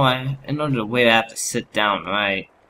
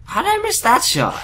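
much higher, disbelieving; exasperated high; 13 semitones higher, 3 dB louder than usual. Real, not acted. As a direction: squeak it out in disbelief. Repeats the missed-shot complaint.

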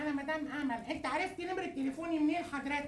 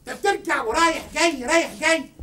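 loud, forceful telling-off; angry confrontation; 13 dB louder than usual. Acted. Confronting the man who offended, angry and very loud. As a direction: lay into him at full volume.

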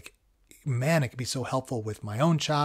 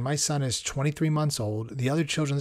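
ordinary delivery

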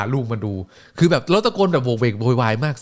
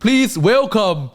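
much higher, louder, long-held announcer call; announcer introduction; 6 dB louder, much slower than usual. Retold or imitated. Announcer presenting Booker T, loud and stretched. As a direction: hold Booker T's name out to the crowd.

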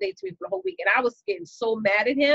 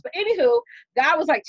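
usual speaking voice

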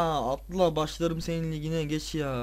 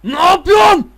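loud, much higher furious yell; real exasperated scream at the screen; angry shout; 11 semitones higher, 12 dB louder than usual. Real, not acted. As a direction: scream at the player for the mistake. Yelling at player on screen.

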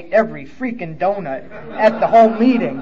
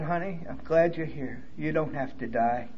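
tender comfort; 3 semitones lower, 10 dB quieter than usual. Retold or imitated. Woman comforting newcomer.

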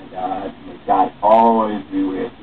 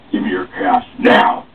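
menacing robber; 6 semitones lower than usual. Acted. Robber demands money.

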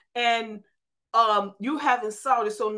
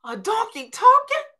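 shocked disbelief; 6 dB louder than usual. Retold or imitated. Preacher imagining meeting a talking donkey, shocked high cry. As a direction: shout it out as if you can't believe it. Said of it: "I would have been like".

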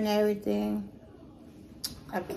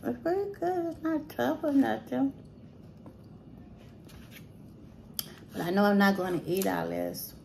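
talking while chewing; chewing a bite of food; mouth full. Real, not acted. Seen 0: Bit food at -3, mouth working while talking, napkin wipe.

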